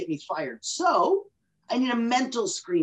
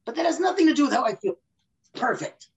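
mock protest; 6 semitones higher than usual. Acted client protest.

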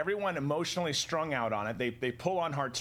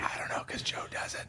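joking whispered excuse; whispering; 4 dB quieter than usual. Real, not acted. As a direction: whisper the answer playfully. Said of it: "Why are we whispering?".

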